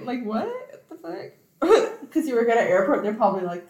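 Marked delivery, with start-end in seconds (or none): 0.5-1.3 high-pitched, incredulous question; baffled exclamation. Acted. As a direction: react in mock disbelief, pitch jumping up. Acting out a baffled reaction.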